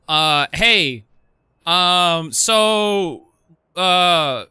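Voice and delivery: halting, loud, awkward phone confession; sheepish confession. Retold or imitated. Employee phoning the owner about the alarm, sheepish. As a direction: drag out the bad news with long hesitant fillers.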